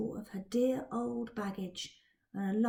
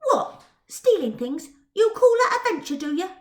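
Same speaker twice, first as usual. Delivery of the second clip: put-on higher, louder indignant girl; Twill's scornful girl voice; 7 semitones higher, 10 dB louder than usual. Twill scorning Dodger's thieving, higher indignant girl voice. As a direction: turn up your nose at him.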